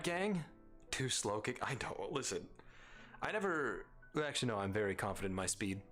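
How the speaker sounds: normal talking voice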